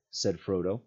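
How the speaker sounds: ordinary delivery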